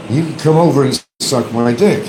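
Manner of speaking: a hint of him inviting people over; mock quote. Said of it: "He's always telling people".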